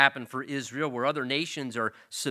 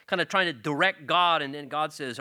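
ordinary talk

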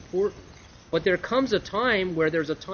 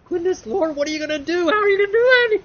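much higher, louder frantic pleading; panicked cry; 9 dB louder, faster than usual. Acted. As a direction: cry out to the Lord in alarm. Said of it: "he starts crying out to the Lord".

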